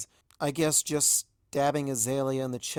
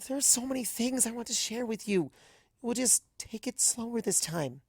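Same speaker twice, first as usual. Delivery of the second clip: higher, gentle coaxing mare voice; hopeful Azalea; 10 semitones higher than usual. A narrator's reading.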